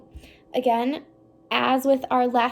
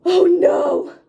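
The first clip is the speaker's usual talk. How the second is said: worried gasp; 6 semitones higher, 12 dB louder than usual. Acted. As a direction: gasp then loud alarmed oh no. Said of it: "how worried he looks".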